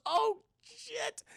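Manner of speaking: high mock dread at the phone. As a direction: jump way up in pitch on oh. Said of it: "He goes".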